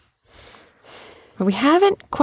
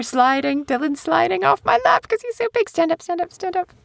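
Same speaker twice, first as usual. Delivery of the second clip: high, hurried alarm; 12 semitones higher than usual. Real, not acted. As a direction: squeal as he slides off. Child sliding off lap.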